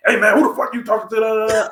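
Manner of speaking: high, heated confrontation; challenging the girlfriend's father; aggressive challenge. Retold or imitated. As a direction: come at him hard with the question. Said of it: "But if I was like".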